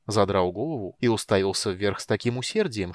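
ordinary delivery

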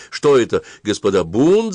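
loud alarmed shout. A narrator's reading. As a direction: cry out in panic. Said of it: "закричал он".

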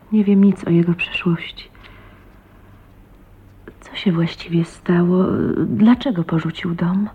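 anxious hushed whisper. Acted. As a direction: barely breathe the questions, afraid to be overheard. Wife asks about husband's past. (niepewny szept)

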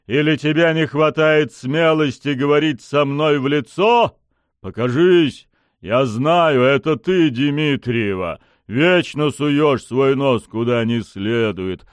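loud, high-pitched challenge; angry demand. A narrator's reading. Pushkin challenges the hidden Dmitrieva, angry demand. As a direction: goad the hidden enemy into coming out. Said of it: "потребовал он".

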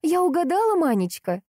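high, sugary mock-sweet question; feigned unctuous sweetness. A narrator's reading. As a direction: coo it with false sweetness. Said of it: "наигранно елейным тоном поинтересовалась". (наигранно елейным тоном)

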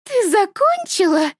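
high, slow and impatient; an impatient mother calling from the doorway. A narrator's reading. Mother at the entrance asks her daughter, impatient. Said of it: "В голосе слышится нетерпение".

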